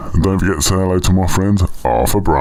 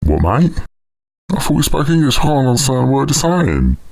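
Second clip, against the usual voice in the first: high-pitched confusion; 8 semitones higher than usual. Acted. Can't understand the alien.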